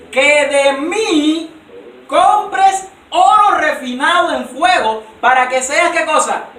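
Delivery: loud, high scripture proclamation; fiery preaching. Acted. A preacher reading the Lord's rebuke to Laodicea, loud and high. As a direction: thunder the verse at the church.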